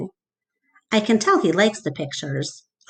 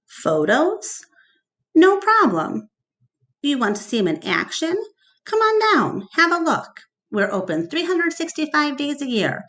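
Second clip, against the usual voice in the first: high, pushy huckster pitch; eager salesman; 6 semitones higher than usual. Acted. Mac pitching his mall to the caller, eager salesman voice. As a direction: sell it hard like a showman.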